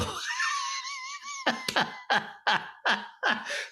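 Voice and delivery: very high, drawn-out wheeze breaking into cackles; real wheezing laugh. Real, not acted. Comic realizing he snubbed a real man, wheezing laugh. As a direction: crack up helplessly, wheezing. Cracks up at his own story.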